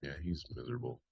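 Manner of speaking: ordinary talk, nothing marked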